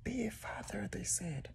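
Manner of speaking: whispered, sardonic aside; wry whisper. Real, not acted. Worn-out dad whispering a wry joke to camera.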